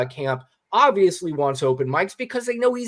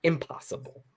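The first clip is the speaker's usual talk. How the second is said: whispered impossible; 9 dB quieter than usual. Real, not acted. Telling the host about Trump's casinos, a whispered aside. Repeating casinos bankruptcy point.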